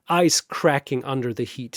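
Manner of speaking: ordinary delivery, nothing marked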